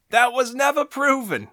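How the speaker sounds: put-on high, indignant Boston-accent holler; Boston accent yell. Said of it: "yelling from the other side of the bar in a Boston accent".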